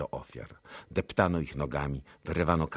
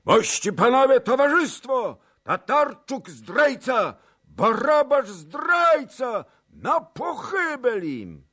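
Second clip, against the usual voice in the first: high, drawn-out rabble-rousing shout; calling to crowd; 10 dB louder, slower than usual. A narrator's reading. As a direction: shout the accusation out to a whole mob. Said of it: "wołał do zgromadzonego tłumu". (gromkie wołanie do tłumu)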